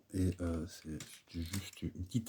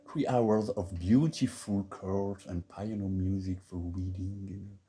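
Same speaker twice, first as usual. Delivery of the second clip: heavy French accent in English; slower than usual. Real, not acted. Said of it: "mon accent anglais peut être parfois dégoûtant".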